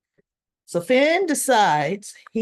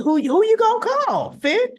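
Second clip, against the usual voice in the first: teasing question; 11 semitones higher than usual. Real, not acted.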